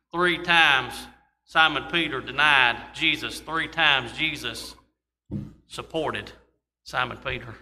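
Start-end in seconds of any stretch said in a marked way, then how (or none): none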